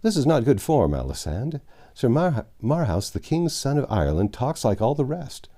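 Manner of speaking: usual speaking voice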